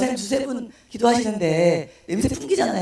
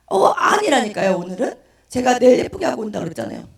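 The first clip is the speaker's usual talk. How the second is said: louder, imitated crying complaint; mock tearful protest; 3 semitones higher, 5 dB louder than usual. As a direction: protest to God like an acted sobbing drunk. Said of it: "제가 막 엉엉 울면서".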